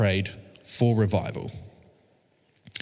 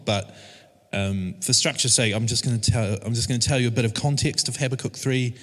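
usual speaking voice

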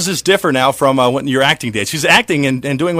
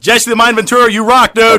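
put-on "Jesse the Mind Ventura" hollered higher and louder like an old-time announcer; 1920s sportscaster voice; 7 semitones higher, 6 dB louder than usual. Host hailing Jesse Ventura, vintage announcer hype. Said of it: "Why did I sound like a nineteen twenties, uh, sportscaster".

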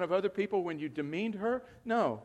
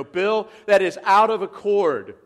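louder, slow challenge; firm objection; 3 semitones higher, 9 dB louder than usual. Pretend. Church member correcting the pastor, loud and slow. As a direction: stand up and object firmly. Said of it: "Somebody better stand up and go".

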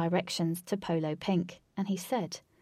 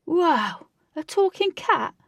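high, loud wow of disbelief; amazed; 10 semitones higher, 8 dB louder, slower than usual. Acted. Flash meeting a talking cat, amazed. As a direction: marvel in a raised voice.

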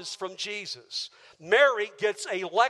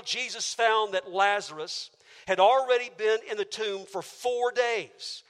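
normal talking voice

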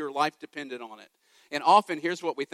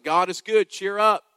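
mock cheerful platitudes; 3 semitones higher, 6 dB louder than usual. Mocking churchgoers who throw Bible verses at hurting people, hammed-up cheerful. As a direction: toss off bright church clichés at a hurting friend. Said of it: "Saying things like".

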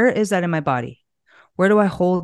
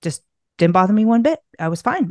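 ordinary talk

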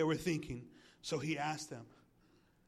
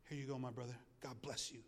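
much softer, lower handing over; gentle mock Peter, softer; soft aside; 6 semitones lower, 11 dB quieter than usual. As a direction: quietly give it to the beggar. Peter handing gold to beggar.

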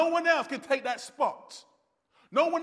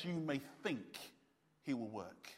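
hushed; 8 semitones lower, 17 dB quieter than usual. Real, not acted. Sermon hush on key point.

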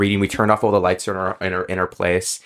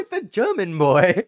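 higher, drawn-out mock German accent; slow, high German-boy impression; 7 semitones higher, slower than usual. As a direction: play up a silly German accent. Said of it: "That's what they sound like".

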